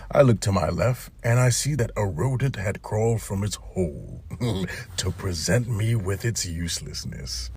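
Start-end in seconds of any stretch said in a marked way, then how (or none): none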